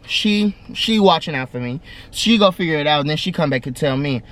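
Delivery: ordinary talk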